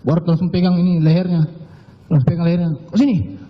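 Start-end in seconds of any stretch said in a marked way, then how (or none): 2.9-3.4 high-pitched, soft imitation of an order; retold command. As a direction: mimic a sharp order without raising volume. Retelling boss ordering him to kneel. (menirukan perintah, nada tinggi tapi pelan)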